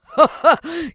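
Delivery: loud, high villain cackle; evil laugh. Acted. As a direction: cackle with cruel delight. Villain laughs at his staff.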